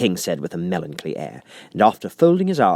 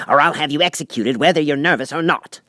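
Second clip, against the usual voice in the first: angry king; 6 semitones higher, 4 dB louder than usual. A narrator's reading. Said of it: "the King repeated angrily".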